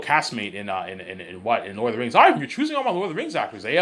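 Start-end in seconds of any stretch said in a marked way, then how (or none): 2.1-3.8 high, loud imagined objection from a director; Peter. Pretend. Director teasing him about taking his actors, high mock protest. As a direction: put on a colleague's protest, pitched way up. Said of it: "Peter, what do you think".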